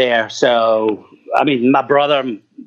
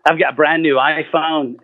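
usual speaking voice

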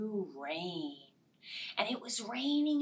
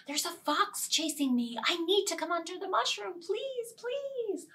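fast, higher scared plea; panicked rabbit; 8 semitones higher than usual. Acted. Rabbit begging to hide from the fox. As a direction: beg in a rush.

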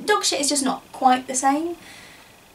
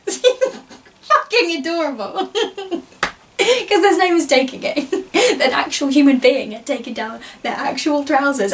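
laughing delightedly; 3 semitones higher, 6 dB louder than usual. Acted. Storyteller delighted by their own little poo flags, laughing. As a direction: crack up while talking, voice raised in glee.

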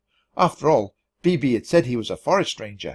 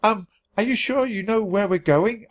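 panicky question; 7 semitones higher than usual. A narrator's reading. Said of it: "I started to panic".

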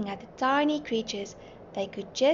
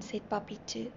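plain reading voice

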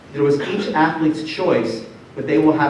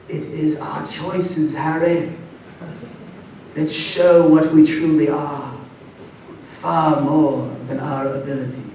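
put-on slow, measured old-wizard accent; hushed, deliberate British accent, halting between phrases; Dumbledore; slower than usual. Speaker imitates Dumbledore advising Harry, slow best-attempt accent. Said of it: "I'm gonna do my best accent".

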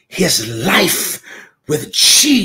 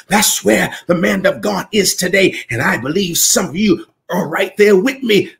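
ordinary delivery

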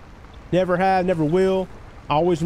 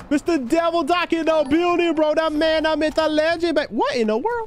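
hype shoutout; 10 semitones higher than usual. Real, not acted. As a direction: stretch it out like a hype announcer. Hyped viewer welcome.

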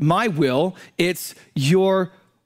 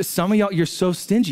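ordinary delivery

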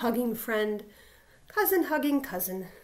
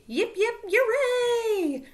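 high, loud, stretched hooray; jubilant cheer; 11 semitones higher, 5 dB louder, slower than usual. Acted. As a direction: cheer the hero as a crowd. Crowd gives three cheers.

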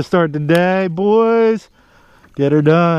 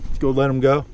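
ordinary delivery

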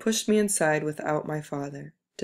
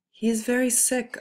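yelled plea; 7 semitones higher than usual. A narrator's reading. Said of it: "I yelled".

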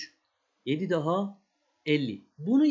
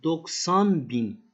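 slow emphatic reading; 2 semitones lower, 4 dB louder, much slower than usual. Shown as a demonstration. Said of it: "Bakın bu bölükte".

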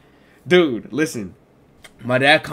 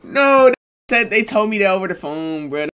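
worked up; 7 semitones higher, 6 dB louder than usual. Real, not acted. Vlogger vents to viewers about the surprise fee, high and loud. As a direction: raise it, he's heated about the fee.

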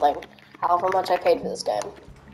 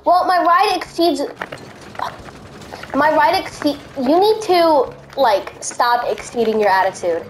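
mocking the game; 5 semitones higher than usual. Mocking game's complaint message.

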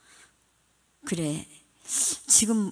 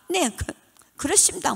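Gyeongsang accent pitched much higher, mock-polite; Gyeongsang accent imitation; 10 semitones higher than usual. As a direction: play a Gyeongsang speaker straining at Seoul speech. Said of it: "경상도도 그렇잖아요". (높은 목소리로 흉내 낸 경상도 사투리)

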